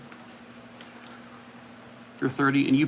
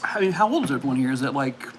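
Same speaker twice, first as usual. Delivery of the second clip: ordinary talk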